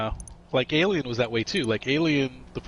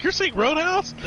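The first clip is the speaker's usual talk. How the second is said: much higher, disbelieving question; incredulous buddy; 3 dB louder than usual. Retold or imitated. School buddy at the theater hears his movie choice, incredulous and pitched up. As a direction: act stunned that he picked Roadhouse. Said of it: "He's like".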